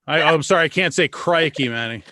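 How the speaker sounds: ordinary talk, nothing marked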